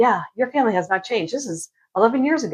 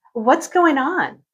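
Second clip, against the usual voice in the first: mock concern; 6 semitones higher, much slower than usual. Sister's fake concern.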